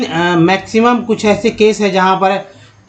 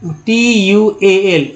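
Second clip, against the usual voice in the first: slow letter spelling; much slower than usual. Shown as a demonstration. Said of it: "इसको बोलते हैं च्वल".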